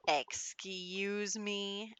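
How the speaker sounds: very drawn-out mock-indignant "excuse me"; acted offended disbelief, slow and incredulous. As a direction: stretch it out slowly like an appalled mom. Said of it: "She is not happy".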